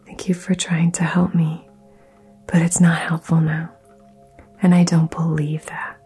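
usual speaking voice

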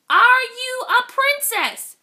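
high, loud, spelled-out repeat. Acted. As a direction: call up again so they hear. Repeating louder to deaf woodpecker.

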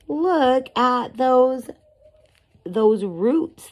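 high, loud, drawn-out wonder; marvelling. Real, not acted. Shows children the roots, marvelling.

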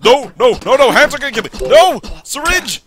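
high, loud cries of alarm; panicked yelling. Real, not acted. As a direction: shout frantically while fighting off danger. Panicking as hands attack in game.

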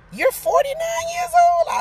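stunned dentist asking her age, high and slow; amazed question. Retold or imitated.

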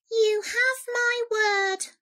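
slow, high little voice; earnest mouse. A narrator's reading. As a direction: squeak a solemn promise. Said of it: "said the mouse".

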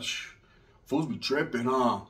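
low, soft laid-back stoner voice; mellow, quiet homie voice; stoned primo. Retold or imitated.

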